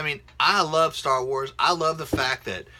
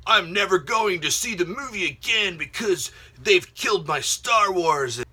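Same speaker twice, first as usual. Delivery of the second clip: high, whiny mock fan, dragging out Star Wars; mock upset fan; 5 semitones higher, 4 dB louder than usual. Vlogger mocking fans who swear off Star Wars, whiny. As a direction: mimic a sulking fan who swears off the movie. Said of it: "People are just like".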